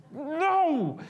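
put-on stubborn kid's refusal, high and drawn out; retold defiant no. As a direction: imitate a child digging in his heels. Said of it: "dug in my seven-year-old heels".

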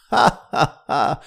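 loud, high burst of laughter; hearty laugh. Acted. Fisherman laughs loudly at a talking fish. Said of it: "zaśmiał się rybak".

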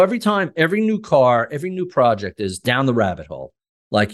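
usual speaking voice

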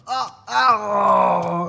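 laughing cry. Real, not acted. Reacting to the stepping noise.

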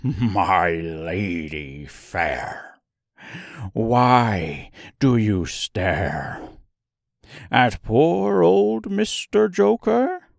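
very slow, saucy clown voice. A narrator's reading. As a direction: tease in a sly clown voice.

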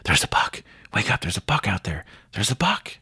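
hushed then rising urgent report; excited wake-up call. Retold or imitated. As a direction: urgently wake your buddy, half whispered. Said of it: "he's like".

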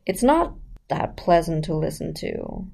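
croaky vocal fry. Shown as a demonstration. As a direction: let the voice crackle into fry. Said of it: "you've heard about the fried voice".

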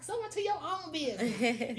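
loud, high retort; loud, high mock comeback; retorting shout. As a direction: throw it right back, loud and high. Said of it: "I'm like".

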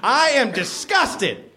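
high, slow mock-outraged yell; acted yell. A narrator's reading. Narrator plays Jim scolding Sam, high mock yell. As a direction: draw it out high in mock disgust. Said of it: "yelled Jim".

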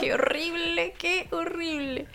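high, drawn-out mock horror. She realizes she became what she criticized, mock horror. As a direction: wail it in playful dismay.

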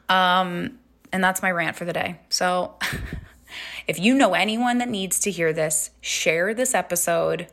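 ordinary talk

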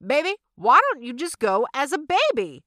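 sweetly pushy mama pitching an idea; mom's voice. Retold or imitated. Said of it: "Mama says".

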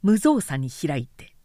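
plain reading voice

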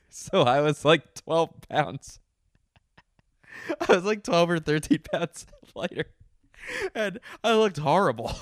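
loud, high, words broken by real laughter; laughing. Real, not acted. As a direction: struggle to finish through the giggles. Cracks up at being underweight.

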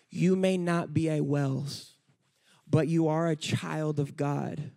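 ordinary talk, nothing marked

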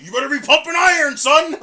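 booming, fast coach bark; gym teacher yell. Retold or imitated. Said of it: "gym teacher that takes you into the workout room and tells you".

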